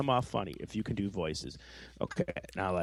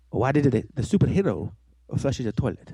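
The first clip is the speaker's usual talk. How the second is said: slightly raised comic character voice; different, funny voice; 3 dB louder than usual. Shown as a demonstration. Testing which reading is funnier, silly character voice. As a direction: read the joke in a put-on silly voice. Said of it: "read it in a different voice".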